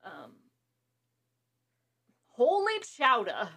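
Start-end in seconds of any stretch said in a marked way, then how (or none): 2.3-3.5 slow, higher and louder "holy chowder"; mock Boston exclamation. Friend mimicking the show's Boston exclamation, slow and hammed-up.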